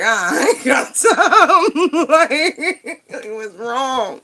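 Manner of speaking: slow, high laughing protest; stretched out in laughter. Real, not acted. Laughing while refusing the young man.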